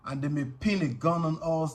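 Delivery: usual speaking voice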